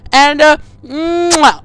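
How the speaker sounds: slow, drawn-out mock swoon into a kiss. Caller swooning at the teacher, slow and hammed-up. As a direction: melt theatrically into a kiss.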